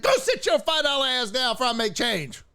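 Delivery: high, hammed-up threat; mock threat. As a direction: act out a cocky threat. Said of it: "It's like".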